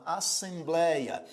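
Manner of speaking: "assembleia" said slowly as as-sem-blei-a, syllable by syllable; split into syllables. Shown as a demonstration. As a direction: stretch "assembleia" out, one syllable at a time. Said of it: "Veja que assembleia você divide assim". ("assembleia" dividida em sílabas, devagar)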